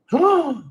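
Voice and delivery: high sharp admiring gasp; mock gasp. Guru acting out people seeing his partner, mock gasp. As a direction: gasp in awe at a stunning beauty. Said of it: "people are like".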